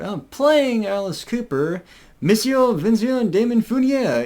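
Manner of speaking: put-on grand stage introduction in a French accent. Fan imitates the singer introducing himself in a French accent. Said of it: "giving it that French, accent".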